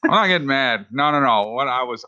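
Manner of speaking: ordinary talk, nothing marked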